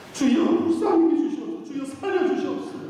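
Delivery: high, wailing imitation of a desperate prayer; mock fervent prayer. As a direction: ham up the crying-out prayer. Mimicking pleading congregation prayer. (교인들이 주께 매달리듯 높은 목소리로 울부짖으며 기도하는 흉내)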